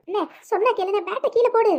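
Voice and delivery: high-pitched urgent appeal to brother; frantic pleading. Acted. Sister begs brother to drop bat. (பதறியபடி கெஞ்சும் குரல்)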